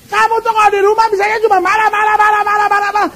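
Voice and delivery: high, fast nagging at her husband; fed-up wife scolding, high and quick. Acted. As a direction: rattle off complaints in a shrill-pitched rush.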